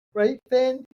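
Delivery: playful high doggy talk; high pet talk. Real, not acted. Asking the dog again, pet talk.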